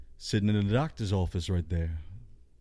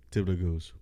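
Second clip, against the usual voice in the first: normal talking voice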